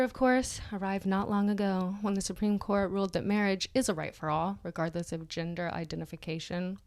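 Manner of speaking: ordinary talk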